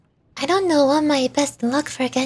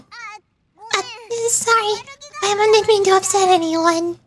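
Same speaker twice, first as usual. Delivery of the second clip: high character voice; 7 semitones higher, slower than usual. Acted. Companion apologizes.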